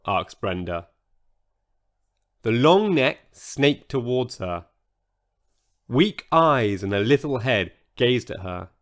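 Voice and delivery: usual speaking voice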